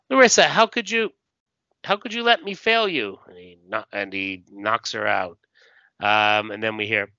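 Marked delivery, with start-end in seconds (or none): 0.1-3.2 much higher, wounded outburst; anguished acted cry. Hero cries out to Larissa before striking her, anguished reproach.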